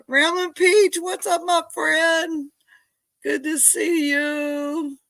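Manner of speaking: high, drawn-out happy call; loud high hello, stretched out; delighted greeting. Real, not acted. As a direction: greet a friend with gushing delight. Greeting a friend arriving in chat.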